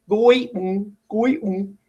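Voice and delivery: "Goi-ng" as GOY, then a vowelless NG; weird wrong split. Shown as a demonstration. Teacher showing pupils it sounds weird, "Goi-ng" split after GOY. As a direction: break "Goi-ng" after GOY, leaving only a hummed ng. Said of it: "Sounds weird, right?".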